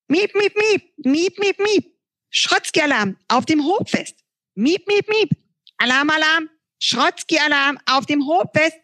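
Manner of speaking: beeping alarm voice. Acted. The Bananophon sounds the Schrotzki alarm, high beeping. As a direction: sound the alarm like a squeaky machine.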